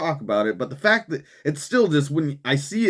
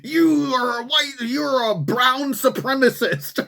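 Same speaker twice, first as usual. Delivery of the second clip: high, slow mocking agitator; mock protester yell; 9 semitones higher than usual. Mocking protesters shouting at churchgoers, high and slowed. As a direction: parody a protester's shrill accusation. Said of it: "screaming at little kids".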